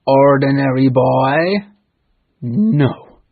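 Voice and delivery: loud, slow, suspicious pirate voice; booming interrogation; gruff questioning. Acted. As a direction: demand it like a wary captain. Hook questions Peter disguised.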